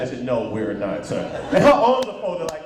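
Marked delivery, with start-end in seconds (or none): 0.0-1.2 annoyed woman low. Retold or imitated. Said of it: "she said".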